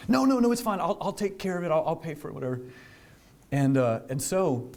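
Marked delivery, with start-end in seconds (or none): 0.1-2.6 higher, hurried brush-off from the other driver; the other driver insisting it's fine, higher and hurried; nervous other driver. Retold or imitated. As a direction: wave it off fast and high.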